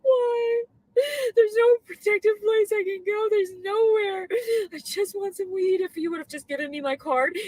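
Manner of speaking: high, loud, tearful wail; real sobbing; desperate weeping; crying. Real, not acted. Crying despair.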